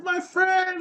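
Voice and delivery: very high, chummy hustler imitation; friendly hustler. Hustler hailing the man he cheated, mock friendly. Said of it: "the next day he's like".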